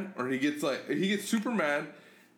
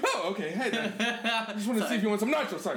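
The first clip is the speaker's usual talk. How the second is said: higher, hurried nervous backpedal; timid apology; 8 semitones higher, faster than usual. Retold or imitated. Son acting his reply to furious dad, sheepish. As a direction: play a kid tiptoeing round an angry dad.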